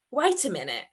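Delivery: ordinary delivery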